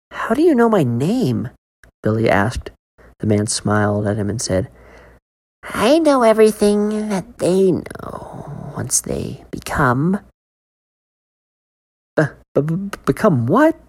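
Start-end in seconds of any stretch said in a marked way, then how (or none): 0.1-1.5 put-on much higher boyish question; frightened boy. Billy asks the man. 5.6-10.2 slow, eerie whisper; sinister whisper. Acted. Man's sinister answer. 12.2-13.8 put-on high, stuttering scared boy; stammered. A narrator's reading. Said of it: "Billy stammered".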